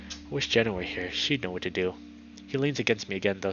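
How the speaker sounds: usual speaking voice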